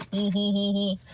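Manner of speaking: quiet, sly snicker; sneaky little laugh. Retold or imitated. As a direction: chuckle sneakily to yourself. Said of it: "You get the little sneaky little laugh".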